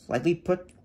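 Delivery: ordinary talk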